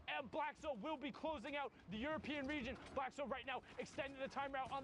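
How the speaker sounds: excited live play-by-play call; esports caster. Acted. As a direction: build the hype of the final. Live play-by-play of final match.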